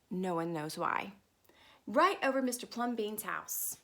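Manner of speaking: usual speaking voice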